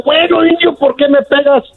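high-pitched acted complaint; lightly voiced indignant question; protesting madam's girl. Struck woman questions the Indian, high mock protest. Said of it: "Y le dijo".

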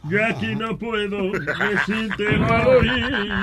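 put-on slow, joking drunk voice. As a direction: play it tipsy and sluggish. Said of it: "yo estoy borracho".

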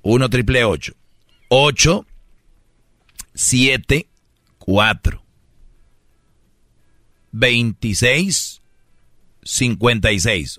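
slow digits with long gaps; deliberately slow dictation. Shown as a demonstration. Host giving critics the phone number, very slowly. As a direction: dictate it patiently, one group at a time. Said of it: "voy a dar el número despacito".

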